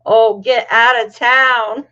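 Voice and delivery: loud, drawn-out exclamation; big, slow incredulous burst; amused disbelief. Real, not acted. Amused disbelief at a viewer's message.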